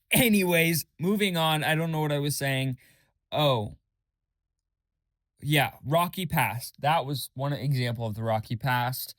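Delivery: usual speaking voice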